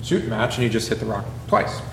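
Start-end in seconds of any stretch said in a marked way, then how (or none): 0.0-0.6 aggravated Moses. Retold or imitated. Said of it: "Moses said".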